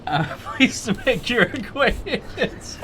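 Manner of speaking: high, slow, laughing politeness; laughing formal greeting. Acted. Player greets the griffin, laughing through courtesy. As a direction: choke back giggles while being courtly.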